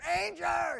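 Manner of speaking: high, loud call of "Ranger!"; calling the dog. Retold or imitated. Still yelling for the dog Ranger: a high, loud call. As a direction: belt the name out like calling a dog across the yard. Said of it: "And I'm yelling".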